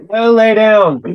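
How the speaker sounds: a real owner scolding the pet; firm command to dog. Real, not acted. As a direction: send the dog back to bed, no nonsense.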